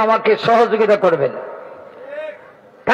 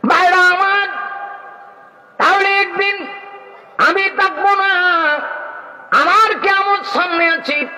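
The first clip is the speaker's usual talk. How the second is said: fervent preaching. Acted. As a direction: preach it with rising fervour. Preaching at full force.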